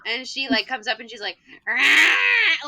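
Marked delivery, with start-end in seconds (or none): 1.6-2.7 short loud monster roar; voracious scream. Retold or imitated. Pupil coming up to scare the teacher, monster scream. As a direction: burst out with a raised-pitch creature scream. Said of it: "doing the Horacious scream".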